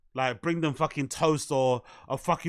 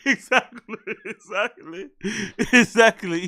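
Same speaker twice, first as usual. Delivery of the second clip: laughing agreement; 3 semitones higher, 3 dB louder than usual. Real, not acted.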